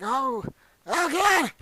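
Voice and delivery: mock panic; panicked cry. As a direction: gasp in terror. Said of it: "I was like".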